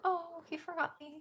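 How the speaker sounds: high, soft little voice; retold letdown in a little voice; small mock disappointment. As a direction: say it tiny, as if left out.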